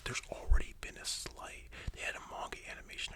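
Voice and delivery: ordinary delivery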